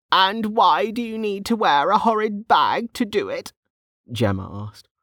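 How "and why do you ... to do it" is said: put-on high-pitched, nosy old woman; questioning old woman. Voices Gemma asking.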